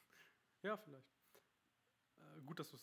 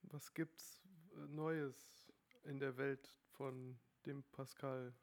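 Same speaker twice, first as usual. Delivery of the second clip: slow drawn out; much slower than usual. Real, not acted. Host playfully introducing topic.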